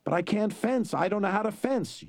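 high, fretful giant voice; worried giant. A narrator's reading. Fezzik protesting to the Sicilian, high and worried. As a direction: protest like a frightened child.

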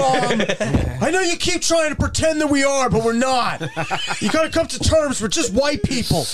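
pleading mock exasperation, pitched high; exasperated retold protest. Grown child arguing with mom on Mother's Day, exasperated. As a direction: set mom straight in a high, fed-up voice.

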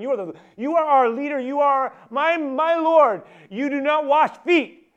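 high, slow, full-volume shouting; vehement, drawn-out protest at the top of the voice; emphatic refusal. Acted. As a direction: shout every word so nobody can miss it. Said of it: "I can't say it any more clear".

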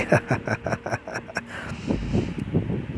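long acted cackling laugh; hard laughter. A narrator's reading. Sweety laughs at cowardly Gulati, hard mocking laughter. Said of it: "जोर जोर से हंसने लगी".

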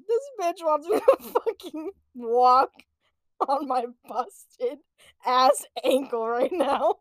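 disbelief broken up by laughter; mock indignation with giggles; incredulous, laughing. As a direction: laugh through the outraged thought. Child's incredulous thought, laughing.

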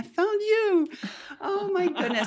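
high, thrilled burst at a discovery; excited. Real, not acted. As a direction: gush with delight at finally finding her. Delighted climax of her fed-up story.

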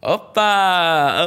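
high, loud "Oppa!" called out to cover the moment; playful shout. Retold or imitated. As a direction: belt it out to play off an embarrassing slip. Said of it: "try to play it off".

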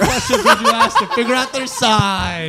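laughing through her own quote, high and loud; retold question cracking up mid-line; laughing mock question. Host retelling her awkward question, laughing through it.

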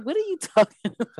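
high, quick mimicry through laughter; Scott. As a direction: crack up while mocking his disbelief. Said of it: "he was like".

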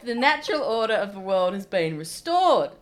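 high, loud celebratory cheer; hammed-up vindication of delighted blokes; mock triumphant blokes. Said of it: "those guys would be like".

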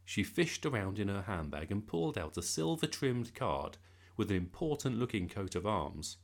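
ordinary delivery, nothing marked

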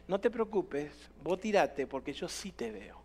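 low, soft fatherly comfort; slow down and soothe, hushed and low; calm reassurance. Acted. Father in the well coaxes his frightened boy, calm reassurance.

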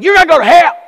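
loud, high southern street-preacher holler; southern accent. Acted. Preacher mimics freaky evangelists accosting someone, shouted southern accent. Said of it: "they always have that southern accent".

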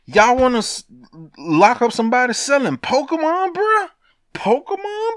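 can't believe they'd arrest him for this; high, outraged disbelief at the feds; incredulous. Real, not acted. Incredulous rant about Pokemon arrest.

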